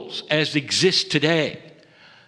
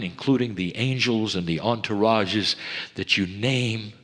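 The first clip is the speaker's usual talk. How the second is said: softer, lower, intimate sermon close; hushed preaching; 7 semitones lower, 5 dB quieter than usual. Acted. Teacher closing the message to his dear ones, hushed. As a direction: draw listeners in with quiet urgency.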